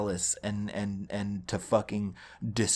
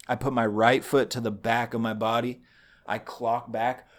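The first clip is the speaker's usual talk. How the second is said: usual speaking voice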